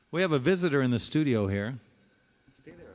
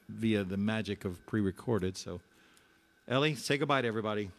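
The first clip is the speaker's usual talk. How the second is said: ordinary delivery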